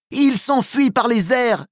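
high, urgent yell. Acted. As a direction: call it out as he flies away. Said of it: "hurle Flash".

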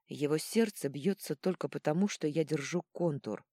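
ordinary delivery, nothing marked

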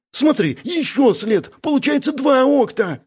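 very high, eager discovery; excited exclamation. A narrator's reading. Бяка finding a second track, exclaiming. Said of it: "и воскликнул".

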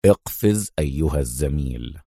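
a relaxed smile behind the words; calm smiling. A narrator's reading. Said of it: "وهو يبتسم في هدوء".

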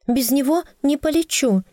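high, insistent plea; desperate high cry. Acted. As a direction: plead high, refusing to leave him. Desperate plea to air spirit.

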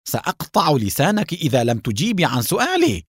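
high, threatening demand; angry shout. A narrator's reading. Haim threatening Mona for answers, shouting angrily. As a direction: rage building to a very high-pitched final question. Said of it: "وصاح بها".

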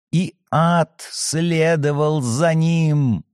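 slow apocalyptic chant; eerie prophetic voice. Acted. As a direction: intone it like a prophet. Possessed girl recites Revelation.

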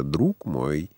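low, soft coaxing address; insinuating. A narrator's reading. Драйер подзывает Франца к сетке, вкрадчиво. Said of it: "сказал он вкрадчиво".

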